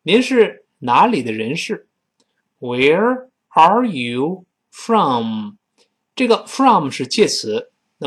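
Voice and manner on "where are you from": drawn out word by word; very slow model. Shown as a demonstration. Teacher drilling a class, very slow. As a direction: stretch every word out. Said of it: "teacher models question slowly".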